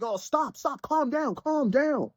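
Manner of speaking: high, hurried mock pleading to stop a fight; imitating someone pulling an attacker off; urgent calming plea. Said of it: "It's like".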